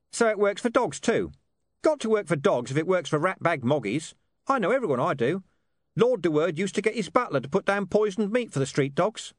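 high-pitched, colloquial talking-dog voice; Gaspode, streetwise and brusque. A narrator's reading. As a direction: rattle it off as a cocky street mutt.

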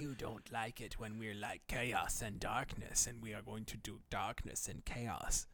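low, softened villain put-on; mock villain. Playing vague conspiracy villains.

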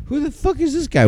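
high-pitched mock disbelief; mock incredulous. Retelling a movie character's reaction, high mock incredulity. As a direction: squeak it as an imitated reaction.

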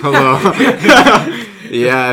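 high, loud hello through laughter; laughing greeting. Real, not acted. Returning guest greeting the listeners, loud laughing hello.

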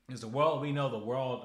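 loud, sudden preacher's warning; fervent outburst. Real, not acted. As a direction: burst out over the lost world. Warning about the lost world.

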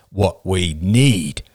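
very slow, measured correction; even and deliberate, word by word. A narrator's reading. Dark-haired man corrects the crying woman, evenly. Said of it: "said the dark-haired man evenly".

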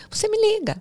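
high-pitched, coaxing retold offer; insistent offer. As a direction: lift it high, urging her to call. Retold offer of help to woman.

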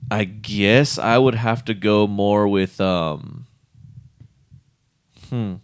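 ordinary talk

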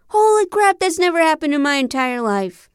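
can't believe the shot went in; excited shock. Acted. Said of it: "To our collective shock".

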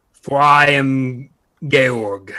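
grandiose proclamation. Acted. Wizard proclaims himself to ogres.

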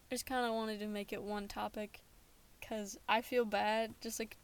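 ordinary talk

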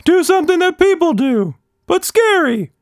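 very high, airy creature voice; a quirky magic blob, offhand; magical blob voice. A narrator's reading.

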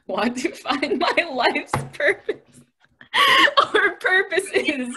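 high laughing, slowed. Real, not acted. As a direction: laugh through the words. Laughing at own resolution.

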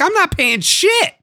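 high, slow, indignant protest; indignant high outburst. Retold or imitated. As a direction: refuse it with high outrage. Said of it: "I'm like".

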